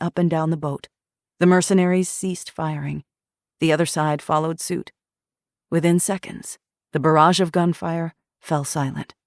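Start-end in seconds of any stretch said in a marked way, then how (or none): none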